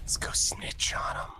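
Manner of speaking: whispered, no voice; unvoiced conspiratorial whisper. Real, not acted. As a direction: hush it like a secret plan. Conspiratorial suggestion to snitch.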